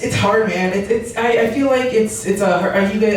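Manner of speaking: usual speaking voice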